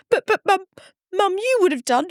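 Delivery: put-on high, tearful teen pleading; mimicking a crying son's high plea. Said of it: "he's crying and he said".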